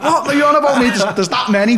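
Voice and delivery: high, loud disbelieving outburst; incredulous retort. Retold or imitated. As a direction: scoff at it in high-pitched disbelief. Incredulous retort in retold banter.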